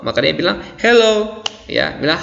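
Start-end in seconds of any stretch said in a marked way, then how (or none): 0.8-1.4 high, lively answering hello; spirited hello. Shown as a demonstration. Teacher playing the person who says hello back, spirited. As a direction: return the greeting with lifted, eager intonation. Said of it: "ada semangat ketika kita berbicara".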